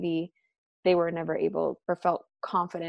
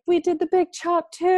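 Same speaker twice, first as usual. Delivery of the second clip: excited friends; 11 semitones higher, 5 dB louder than usual. Retold or imitated. Excited friends announcing chop.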